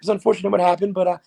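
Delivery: lightly voiced ballplayer dodging the question; David Wright. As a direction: rattle off a polite canned answer. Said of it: "you know him. He'd just be like".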